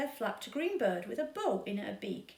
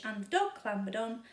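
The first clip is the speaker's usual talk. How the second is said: ordinary delivery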